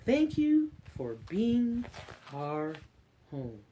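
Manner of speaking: slow storybook read-aloud; reading to children. Acted. Librarian reading an Earth book to kids, storybook voice. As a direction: read it gently to a class of little ones.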